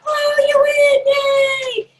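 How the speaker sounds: high, loud celebratory squeal; gleeful high party-host shout; cheering the winner. Acted. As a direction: celebrate with an over-the-top cheer. Acting out announcing a winner.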